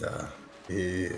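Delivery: ordinary delivery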